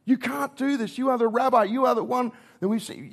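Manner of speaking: can't believe the rabbi is doing this; a disciple protesting, half-acted disbelief; incredulous disciple. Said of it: "They would have been looking around".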